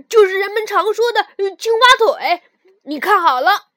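loud, proud frog teacher voice; loud showing off. Acted. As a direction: shout it out proudly to a friend. Frog shows off frog kick.

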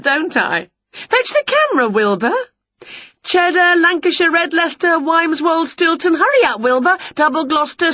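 high-pitched, excited storybook witch; gleeful Winnie. Acted. Winnie posing for her cat's camera, gleeful. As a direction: rattle on high, ending in a long, loud squeal.

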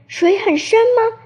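high-pitched, surprised question; startled question. A narrator's reading. Little horse asks the squirrel about the river, startled. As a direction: ask in storybook astonishment. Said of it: "吃惊地问".

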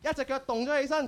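ordinary talk, nothing marked

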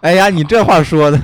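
loud, high Dongbei exclamation; northeastern Chinese accent. Pretend. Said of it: "这期节目就用东北话聊吧".

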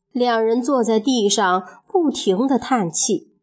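ordinary delivery, nothing marked

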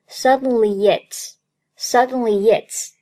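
"suddenly it's" joined as "suddenly-yits"; linking y glide. Shown as a demonstration. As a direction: slip a y between "suddenly" and the next word. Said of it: "中间可以加一个yet的音".

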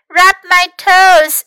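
high, loud little-one voice; small child's voice. A narrator's reading. Hurt Louis orders Fox about, high small voice. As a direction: make demands in a high small voice.

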